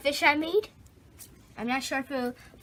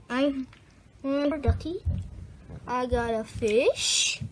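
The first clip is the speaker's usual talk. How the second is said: usual speaking voice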